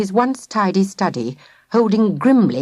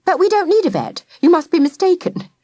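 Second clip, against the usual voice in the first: higher polite mother voice; puzzled mother at the door; mother's voice; 10 semitones higher, 4 dB louder than usual. A narrator's reading. Mother's voice in story.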